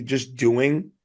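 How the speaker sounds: normal talking voice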